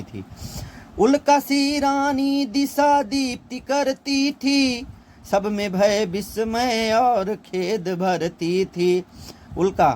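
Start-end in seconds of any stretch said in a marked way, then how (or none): 1.0-4.9 slow, much higher, drawn-out verse recital; Kaikeyi's lament recited slowly as verse; poem recitation. Acted. 5.3-9.0 "सब में भय विस्मय और खेद भरती थी" recited slowly as verse; slow lyrical reading of "सब में भय विस्मय और खेद भरती थी"; poem recitation. Acted.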